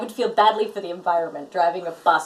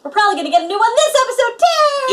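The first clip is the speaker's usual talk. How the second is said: very high, fast rush into a squeal; giddy excitement; 13 semitones higher, 5 dB louder than usual. Real, not acted. Fan telling co-host a new ranger is coming, giddy. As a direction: burst in gleefully, climbing to a loud last word.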